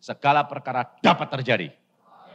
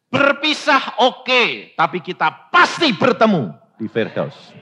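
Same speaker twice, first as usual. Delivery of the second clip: slow, louder and higher, insisting; firm exhortation; 6 semitones higher, 6 dB louder than usual. Retold or imitated. Father rebukes weeping family, slow loud insistence. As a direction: make them believe it.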